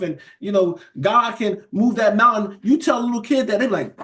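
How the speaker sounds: high, rising preacher's exhortation; preaching at full force. Acted. Preacher urging the congregation to resist doubt, fired-up preaching. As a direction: drive it home to the church, pitch climbing.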